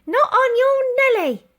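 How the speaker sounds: very high, loud indignant snap; lady refusing the old soldier; snapped. A narrator's reading. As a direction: refuse her sharply. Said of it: "snapped the lady".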